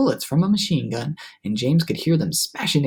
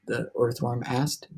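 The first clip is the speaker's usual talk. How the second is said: usual speaking voice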